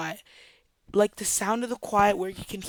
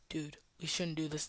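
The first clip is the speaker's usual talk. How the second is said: much softer, hushed warning; real quiet; 3 semitones lower, 14 dB quieter than usual. Retold or imitated. Luke leans over to his friend, real quiet. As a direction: lean in and say it under your breath. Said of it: "goes real quiet".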